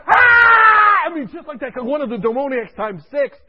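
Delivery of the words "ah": loud high drawn-out scream; patient's yell. Retold or imitated. Patient screaming at the nurse, loud imitated yell. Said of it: "Sam is yelling".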